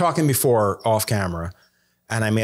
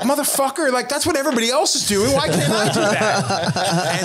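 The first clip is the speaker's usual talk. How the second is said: much higher, laughing protest; mock exasperation; 13 semitones higher than usual. Answering his critics, high laughing mock protest. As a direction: throw up your hands, half laughing. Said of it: "And I'm just like".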